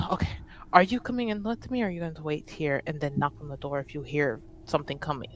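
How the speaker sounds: usual speaking voice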